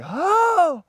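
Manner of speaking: sudden high whoop of joy; relieved triumphant holler; excited yell. Retold or imitated. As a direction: let out a big stoked yell. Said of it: "I just let out a good".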